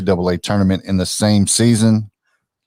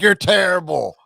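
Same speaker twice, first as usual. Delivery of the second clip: mock heckling; 11 semitones higher than usual. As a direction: blurt it out as a jeering fan, high-pitched. Mimics harsh fans over others.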